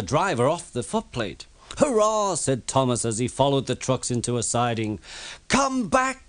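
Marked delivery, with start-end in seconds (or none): 1.8-2.4 high, gleeful hurrah; cheering. Acted. Thomas cheering as he follows the trucks, high and gleeful. 5.5-6.3 calling out. A narrator's reading. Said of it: "called his driver".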